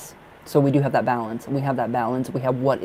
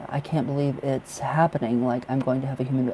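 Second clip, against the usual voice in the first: ordinary talk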